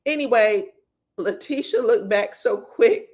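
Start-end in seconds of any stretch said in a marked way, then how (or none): none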